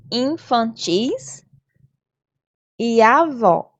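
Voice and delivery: "infantis" and "avó" slow, landing on TIS and VÓ; stressed final syllable. Shown as a demonstration. Teacher giving third-graders examples "infantis" and "avó", carefully stressing the last syllable. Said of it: "Percebam que a sílaba tônica está em destaque".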